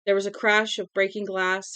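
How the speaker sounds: ordinary delivery, nothing marked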